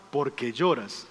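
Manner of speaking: low, soft fatherly question; gentle questioning. Retold or imitated. Father to his crying five-year-old son, gentle questioning.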